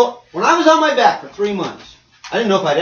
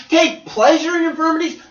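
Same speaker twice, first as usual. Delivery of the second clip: incredulous echo; 9 semitones higher, 4 dB louder than usual. Acted. Echoes verse in disbelief.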